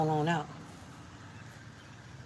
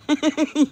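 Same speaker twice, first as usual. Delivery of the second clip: high burst of laughter; 11 semitones higher, 8 dB louder than usual. Real, not acted. Laughs at the bug.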